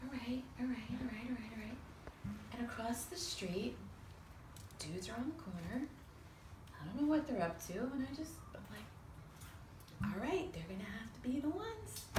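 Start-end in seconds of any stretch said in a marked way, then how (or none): 0.0-1.8 whispered self-talk. Retold or imitated. Psyching herself up alone. 10.0-12.1 breathy whisper to herself; half-whispered private decision; whispered thought. Retold or imitated. Deciding privately whom to ask.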